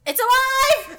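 high loud startled cry; startled shout. Real, not acted. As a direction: shout in shock. Spider moves, shout.

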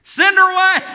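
high loud annoyed cry; fed-up disciples yelling to be rid of her; exasperated yell. Acted. Disciples' exasperated demand mimicked.

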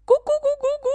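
high, loud hen clucks; 母鸡高声咕咕叫; hen clucking. Acted. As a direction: cluck away like a chicken. Hen clucks attacking farmer.

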